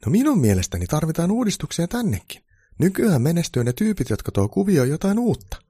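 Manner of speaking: high, loud keen elf voice; eager reformer elf. A narrator's reading. Elf pitching reforms to Santa, high and eager.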